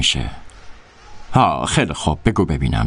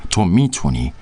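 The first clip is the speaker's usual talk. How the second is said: ordinary delivery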